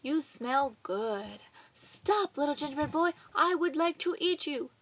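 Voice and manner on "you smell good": sniffing cow. Acted. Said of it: "sniffed the cow".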